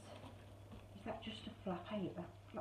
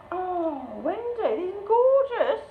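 high, loud, drawn-out delight; excited gushing; 7 semitones higher, 6 dB louder, much slower than usual. Real, not acted. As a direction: gush to a generous friend, stretching the words. Gushes over gifts from Wendy.

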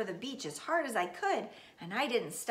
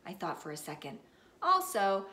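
ordinary delivery

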